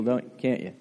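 ordinary talk, nothing marked

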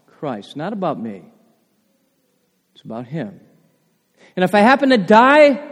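ordinary talk, nothing marked

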